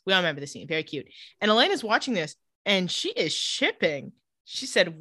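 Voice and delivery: normal talking voice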